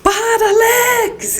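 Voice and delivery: high mock yell from the kitchen sink; yelling across the room. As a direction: call out across the house, half laughing. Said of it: "Eu gritava lá da pia".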